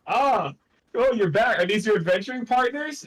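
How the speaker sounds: high, gushing family greeting; delighted welcome. Acted. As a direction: greet a returning child with delighted surprise. Family greets returning adventurer in story.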